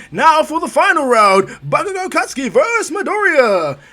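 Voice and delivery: loud, high, drawn-out tournament announcement; big hyped-up fight announcer call; arena announcer. Acted. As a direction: boom out the final match-up to a crowd. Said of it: "President Michael was about to announce".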